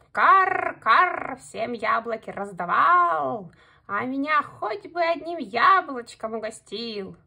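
loud, slow, grumbling crow voice; cawing crow. A narrator's reading. Crow grumbles to the hare about apples, loud cawing. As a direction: caw and complain like a jealous crow. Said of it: "А ворона всё каркает".

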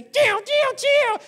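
very high shouted mock ricochet sounds; dodging noise. A kid dodging his friend's pretend bullets, high squealing noises. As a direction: squeal the bullets whizzing past.

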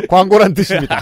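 loud high ad-read tag; ad announcer voice. Shown as a demonstration. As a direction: announce it like a commercial. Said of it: "저는 이제 톤으로 알려드리는 거죠".

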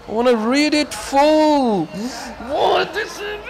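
high, drawn-out exasperated yell; frustrated screaming. Real, not acted. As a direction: wail it at the game, stretching the words. Rages at the broken game.

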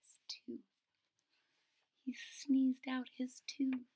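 hushed whisper; whispered reveal. A narrator's reading. Narrator revealing the sneezed tooth, whispering. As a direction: whisper the surprise to kids.

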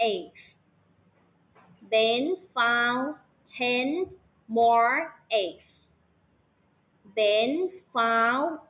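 put-on careful read-aloud for young learners, word by word; slow reading demo. Shown as a demonstration. Teacher showing pupils how to read the story, slow and word by word. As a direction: read the story slowly, one word at a time. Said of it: "อีกรอบหนึ่งพร้อมกันนะคะ".

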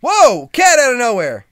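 high, loud startled yell; startled shout. Real, not acted. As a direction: caught off guard by a pouncing cat. Cat jumps on him suddenly.

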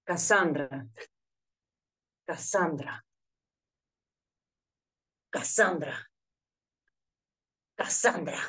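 low, practising the name; searching for the voice; voice practice. Shown as a demonstration. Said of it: "That doesn't sound right".

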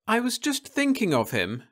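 put-on high, dreamy young woman; Florence's voice. A narrator's reading. Wife musing to her husband, high and dreamy. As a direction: muse aloud in a high voice.

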